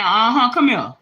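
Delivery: knowing, teasing call; a hint of God beckoning you over; lightly voiced teasing God. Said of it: "God have a way of saying".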